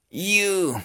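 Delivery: high, drawn "You" gliding like "yiu"; posh-style "you". Shown as a demonstration. Teacher showing the English u, posh-style "You". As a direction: say "You" with a snooty ee-glide. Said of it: "se pronuncia como si fuera bien gomelito".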